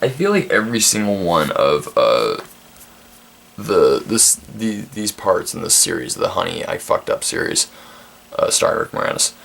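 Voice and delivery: ordinary talk, nothing marked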